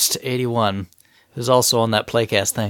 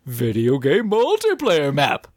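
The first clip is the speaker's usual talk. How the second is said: ghost voice; 6 semitones higher than usual. Acted. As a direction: read it like a spooky ghost. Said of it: "I'm gonna put on my best Grey Ghost voice".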